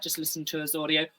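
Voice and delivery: usual speaking voice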